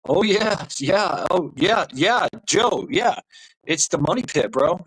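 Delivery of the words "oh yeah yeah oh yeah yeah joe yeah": excited agreement. Real, not acted. Enthusiastic agreement with chat.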